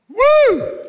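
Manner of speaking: high whoop of delight; excited woo. Real, not acted. Excited about Jesus' promise.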